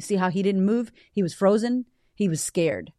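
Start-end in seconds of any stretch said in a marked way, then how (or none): none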